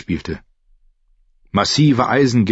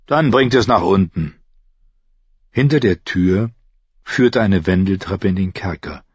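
ordinary delivery

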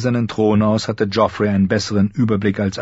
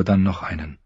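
ordinary delivery

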